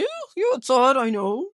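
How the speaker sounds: imitating a loud, high, disgusted squeal; Scarlett. Retelling a friend's reaction, mock disgust. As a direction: play up the grossed-out ew, high and loud. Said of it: "And Scarlett's like".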